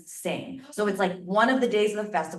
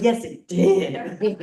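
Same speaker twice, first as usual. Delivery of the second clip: laughing; 3 semitones higher, 6 dB louder, much slower than usual. Retold or imitated. Laughing agreement with friends.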